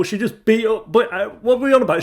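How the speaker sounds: loud, high-pitched disbelief; outraged reaction. Real, not acted. Viewer reacting to Martha's next-level behaviour, outraged. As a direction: burst out loud and incredulous.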